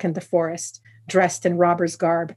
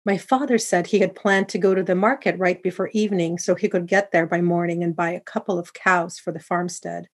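ordinary delivery, nothing marked

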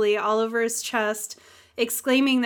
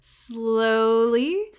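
drawn-out, rising "slowly"; cautious approach; much slower than usual. Retold or imitated. Host voices an alien approaching Deanna, drawn out and cautious. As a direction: coax it out slowly, as if nearing a wild animal. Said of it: "like she's a wild animal in the zoo".

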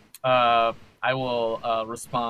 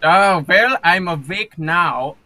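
loud, slow groan of waking up; groggy loud waking; 4 semitones higher, 10 dB louder, slower than usual. Acted. Captain wakes on the wet deck, groaning loudly.

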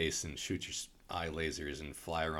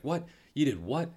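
higher, louder disbelieving what; shocked; 9 semitones higher, 6 dB louder than usual. Retold or imitated. Han reacting to Finn's janitor confession, shocked. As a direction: snap back in disbelief. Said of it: "Han's like".

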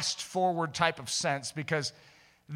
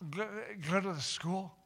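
soft, halting, timid request; small hesitant voice, feeling for the words; imitating the girl; 3 semitones higher, 6 dB quieter than usual. Girl at lunch asking to visit school, halting. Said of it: "what does she say".

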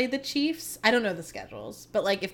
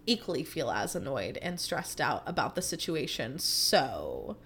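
ordinary talk

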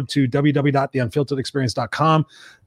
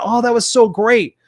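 high, drawn-out mock gushing; gushing enthusiasm; 9 semitones higher, 3 dB louder than usual. Host imitating an inspired listener after the show, gushing. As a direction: gush like an inspired fan, stretching the words.

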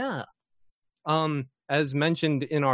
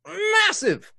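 MASSIVE thrown up high and louder; hyped emphasis; 14 semitones higher, 5 dB louder than usual. Real, not acted. Host announcing a giveaway, big high stress on MASSIVE. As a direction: blow up the word massive like a showman.

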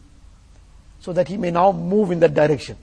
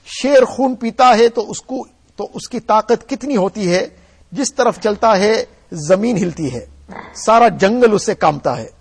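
rapid text recitation; 3 semitones higher, 5 dB louder, much faster than usual. Acted. Reads Urdu text before translating.

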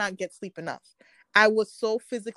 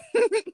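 laugh; 13 semitones higher, 4 dB louder than usual. Real, not acted. Laughing about her panic attack.

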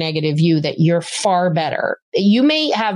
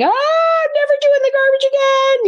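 put-on much higher, wailing child protest; whiny kid meltdown; 3 dB louder than usual. Mother imitating son refusing garbage, wailing. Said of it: "he would have been like".

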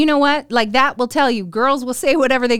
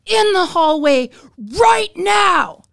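slow, higher imitated teacher command; stern order; 6 semitones higher, slower than usual. Teacher ordering the student into the hallway, mock stern shout. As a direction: bark it like a fed-up teacher.

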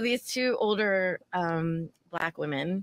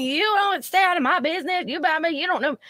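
much higher mock screech; mocking drunk woman; 9 semitones higher than usual. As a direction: imitate her rambling rant. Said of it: "she kept going".

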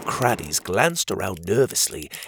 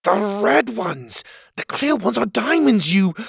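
put-on higher, impatient boy voice; exasperated Pockets; 9 semitones higher than usual. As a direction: snap the answer, a little fed up.

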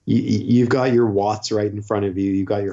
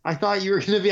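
lightly voiced relieved friend; 8 semitones higher than usual. Philip's relieved retold reply.

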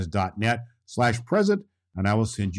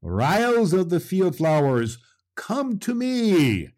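calling out; 8 semitones higher, slower than usual. A narrator's reading. Claus summons the field ryles.

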